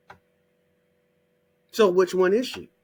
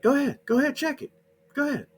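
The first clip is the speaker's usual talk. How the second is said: daring challenge; 10 semitones higher, faster than usual. Real, not acted. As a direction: egg them on to go check. Daring listeners to check.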